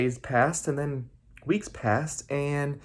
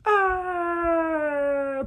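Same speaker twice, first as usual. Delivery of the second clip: far-off high cry fading; distant high cry. Acted. Last Trid friends flung away, distant high cry.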